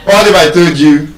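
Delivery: ordinary delivery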